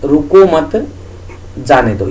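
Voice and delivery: "रुको मत" together, a break, then "जाने दो" falling low; pause after मत. Shown as a demonstration. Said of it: "यहां से आप यत-, यति अच्छी तरह समझ पाएंगे". ("मत" के बाद ठहराव, फिर "जाने दो")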